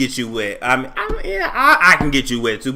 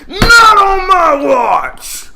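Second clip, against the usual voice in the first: loud, high mock protest; 13 semitones higher, 9 dB louder, much slower than usual. Voicing Iyanla stepping in.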